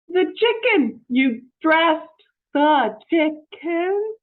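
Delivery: loud, high, slowing disbelief; hammed-up shock at the dressed chicken; alarmed Missus Rogers.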